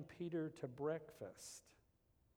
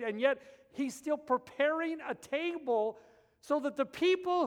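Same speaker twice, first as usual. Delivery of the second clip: loud, high, driving preacher voice; rising revival sermon delivery; preaching at full force; 9 semitones higher, 12 dB louder than usual. Acted. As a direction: preach it out to the back row.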